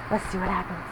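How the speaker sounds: usual speaking voice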